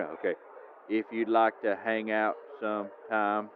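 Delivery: reading each cue card slowly and stiffly; slow stilted reading. Acted. Superfan reading his question to the coach, slow and stilted. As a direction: read it off the card one slow word at a time.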